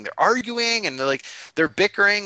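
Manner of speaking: "arguing" and "bickering" pitched high and leaned on; emphasized complaint. Real, not acted. GM complaining the party just bickers, stressing "bickering". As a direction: hit "arguing" then "bickering" harder. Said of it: "I'm trying to emphasize that".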